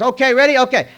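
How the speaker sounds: high, loud set call; clapper girl. Retold or imitated. Clapper girl readies the set, loud high call.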